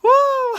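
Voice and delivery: loud high whoop of delight; sudden joyful whoop. Real, not acted. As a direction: let out a big woo. Excited whoop mid-speech.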